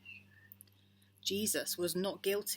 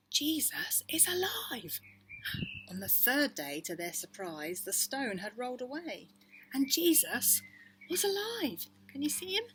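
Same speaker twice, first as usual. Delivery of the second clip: excited; 8 semitones higher, 4 dB louder than usual. Acted. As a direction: burst out with delight. Joyful Easter reveal.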